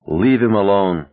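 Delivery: usual speaking voice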